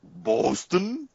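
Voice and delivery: high, questioning take on a bad Boston accent; mock a friend's botched Boston accent, rising; teasing high-pitched mimicry of a bad Boston accent; mimicked botched accent. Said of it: "and Slick goes".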